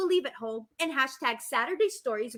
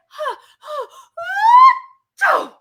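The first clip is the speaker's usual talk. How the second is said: high, loud hammed-up sneeze build-up; 18 dB louder than usual. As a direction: wind up and let a big sneeze rip. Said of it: "Amos's turn to sneeze loudly".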